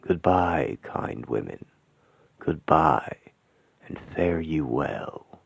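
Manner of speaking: normal talking voice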